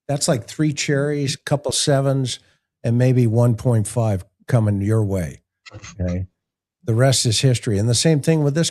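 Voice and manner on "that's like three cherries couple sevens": usual speaking voice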